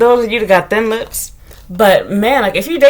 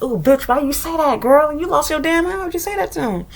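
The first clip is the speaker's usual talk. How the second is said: higher, softer scolding inner voice; scolding herself; 5 semitones higher, 5 dB quieter than usual. Retold or imitated. Telling herself off for what she said to him, high and soft. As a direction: scold yourself in a shocked whisper-ish tone. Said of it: "And I was like".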